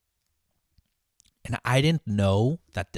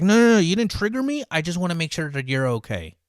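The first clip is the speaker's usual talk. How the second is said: imitated defensive chef; 7 semitones higher, 7 dB louder than usual. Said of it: "And he's like".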